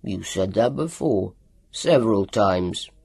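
low, slow dry grunt; a low, unimpressed doctor. A narrator's reading. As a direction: grunt it back dryly as the doctor. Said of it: "Doctor Welander grunted".

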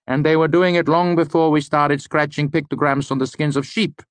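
high-pitched eccentric lecturer, correcting; Elodin. Acted. Lecturer contradicting a student about written language, high-pitched.

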